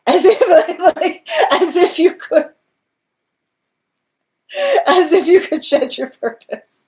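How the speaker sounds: very high, loud, laughing incredulity; real laughter bursting through the words; helpless laughter. Real, not acted. Reader scoffing at being asked to shed purpose, laughing hard. Cracks up at absurd request.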